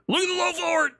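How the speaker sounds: high, hyped-up exclamation; excited. Real, not acted. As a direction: call it out in disbelief. Hyped reaction to move.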